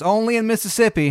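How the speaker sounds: put-on high, loud child voice; little girl. Little girl answering the new neighbor, high-pitched. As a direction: chirp it up like a young girl. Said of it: "little girl".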